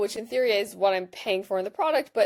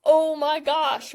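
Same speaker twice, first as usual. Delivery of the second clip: higher, louder, slow gushing "Oh my gosh"; delighted gush; 6 semitones higher, 5 dB louder, much slower than usual. Pretend. Delighted user thanking the app for emails, slow and gushing. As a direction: stretch out "Oh my gosh" in pitched-up delight. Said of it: "they kinda should take the W here".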